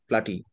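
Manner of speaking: "প্লাটি" said as a mocking wrong form; mock wrong reading. Shown as a demonstration. Teacher to the doctor, a mock wrong reading. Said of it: "পি এল এ টি".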